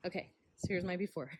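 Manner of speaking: panting after hauling bricks; out of breath, quick words. Real, not acted. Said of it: "I'm very out of breath again".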